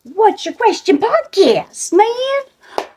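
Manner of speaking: high-pitched podcast intro shout-out; show-title announcement. Real, not acted. Host announcing her show's title.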